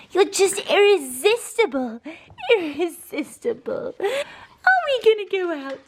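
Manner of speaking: high, loud, gushing pet voice. Real, not acted. Coaxing her lazy dog Wiggy out, high gushing baby talk. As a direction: coo over the irresistible pup.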